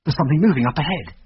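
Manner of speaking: ordinary delivery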